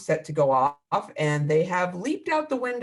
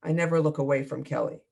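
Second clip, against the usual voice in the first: plain reading voice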